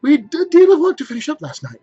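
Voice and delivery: put-on high, loud girl's voice; young girl. Martha explains to her mother in a high girl's voice. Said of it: "replied the girl".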